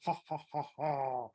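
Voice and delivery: low, soft staged ha-ha; mouse's sly laugh. Acted. Mouse has fooled the snake, sly low laughter. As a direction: laugh slyly at the trick.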